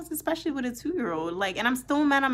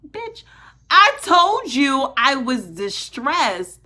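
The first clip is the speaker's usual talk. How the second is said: retold angry retort; 5 semitones higher, 9 dB louder, slower than usual. Furious retort at dispatcher.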